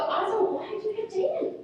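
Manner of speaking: sing-song mommy asking why; mom questioning child. Retold or imitated. Mother questioning her child, retold.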